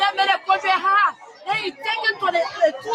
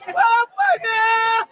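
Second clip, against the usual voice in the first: slow sobbing cry; tearful wail; real crying; 6 semitones higher, 4 dB louder, much slower than usual. Real, not acted. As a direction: break down in tears. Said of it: "don't cry now".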